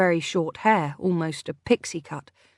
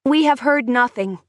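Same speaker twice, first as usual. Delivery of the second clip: firm and a touch louder; 6 semitones higher, 5 dB louder than usual. A narrator's reading. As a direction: shut the topic down firmly. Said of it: "said Hanni firmly".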